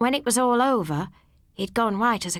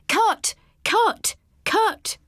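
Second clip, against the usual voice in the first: high, loud shouted calls; shouting Cut; 13 semitones higher, 3 dB louder, much slower than usual. A narrator's reading. Film director shouting at actors to stop the scene, loud high calls. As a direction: bark it out like a director stopping a take. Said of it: "walk across shouting".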